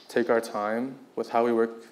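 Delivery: normal talking voice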